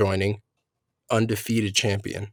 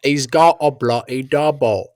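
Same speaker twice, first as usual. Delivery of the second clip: put-on Cockney accent, louder and hammy; gruff Cockney butler line; Michael Caine impression; 3 semitones higher, 4 dB louder than usual. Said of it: "Michael Caine's voice is hilarious".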